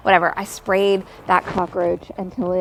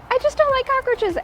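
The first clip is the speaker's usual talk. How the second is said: flustered high whine. Retold or imitated. Answering son, flustered.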